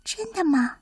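soft, high, timid question; small voice. A narrator's reading. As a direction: ask shyly in a tiny hedgehog voice. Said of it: "然后用小小的声音说".

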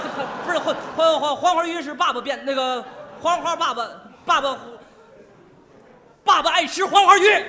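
loud tongue-tangled panic building to a high shout; flustered stammering. Acted. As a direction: trip over the words, then bellow the answer. Father stammers, then bursts out nonsense.

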